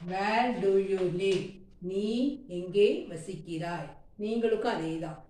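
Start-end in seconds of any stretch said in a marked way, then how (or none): 0.1-1.4 slow, careful, word by word; slow model sentence. Shown as a demonstration. Teacher to Tamil students, giving the English slowly. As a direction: say it slowly so learners can follow. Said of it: "teacher models full sentence".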